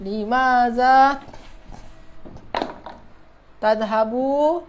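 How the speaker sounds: stretched words, very long pauses between; word-by-word dictation. Shown as a demonstration. Teacher rewriting the sentence for the class, slow dictation. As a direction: spell it out slowly, word after word.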